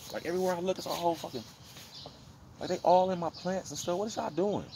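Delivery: high-pitched, fed-up outburst at pests; exasperated. Real, not acted. Fed up with spiders in plants.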